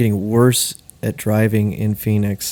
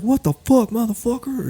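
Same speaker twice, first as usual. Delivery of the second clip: much higher mock road-rage yell; angry driver yelling; 12 semitones higher than usual. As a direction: imitate a furious driver, high-pitched. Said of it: "Or yelling at me".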